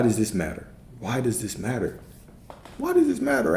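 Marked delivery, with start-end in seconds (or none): 2.8-3.6 high sing-song question. Real, not acted. Coach calling out to Alex in a high sing-song. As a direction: pitch leaps way up on "does".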